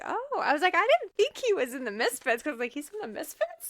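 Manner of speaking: high-pitched mock disbelief; retold surprise. Host on learning of the film's goof, retold surprise. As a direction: replay the surprise, pitched well up. Acting out own surprised reaction.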